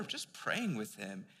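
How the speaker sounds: normal talking voice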